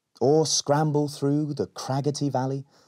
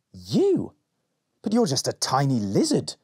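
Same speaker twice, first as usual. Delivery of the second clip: surprised; 4 semitones higher than usual. Acted. Rowan surprised by lizard.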